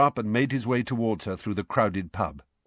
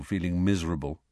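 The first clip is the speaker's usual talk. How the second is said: usual speaking voice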